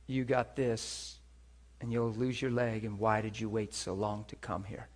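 low, slow, quiet reproach; judgmental. Retold or imitated. Hospital staff scolding a patient for waiting, low and judgmental. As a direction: deliver it low, passing judgment. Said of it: "You hear the judgment in all that?".

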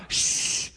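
sharp unvoiced shush; hushing. Crowd hushing the blind man, a sharp shush. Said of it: "They're hushing this man".

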